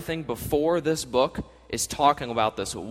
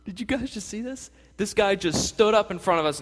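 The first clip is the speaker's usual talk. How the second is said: mock astonishment, pitched way up; amazed bystander; 9 semitones higher than usual. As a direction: gasp it out like a stunned onlooker. Acted bystanders amazed at a healing.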